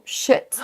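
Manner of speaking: "shit" with a short, lax I. Shown as a demonstration. Teacher imitating French learners' error, short I in "shit". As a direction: say "shit" for sheet, vowel cut short. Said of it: "faire un son I court".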